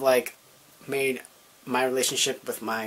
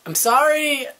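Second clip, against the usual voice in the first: much higher, louder, breaking apology; tearful apology; 13 semitones higher, 7 dB louder, slower than usual. Retold or imitated. Caught by his stepdad about his boyfriend, a high tearful cry of sorry. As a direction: cry out sorry as tears start. Confronted by stepdad, bursting into tears.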